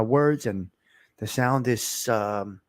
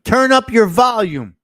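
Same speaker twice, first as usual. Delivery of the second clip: much louder and higher, near a shout; shouted request; 10 semitones higher, 10 dB louder than usual. Shown as a demonstration. Host repeats the request to turn up, shouting it. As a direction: call it out so even a low speaker hears. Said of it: "Speak up too low".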